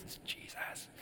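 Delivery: breathy hushed punchline, very soft; whispered reveal. Acted. Pastor hushing the promo's punchline, a whisper.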